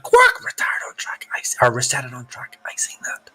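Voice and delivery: hushed whisper aside; urgent whispered commentary. Real, not acted. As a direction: whisper it like a secret to the mic. Quiet aside about a crash.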